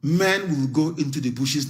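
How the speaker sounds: ordinary talk, nothing marked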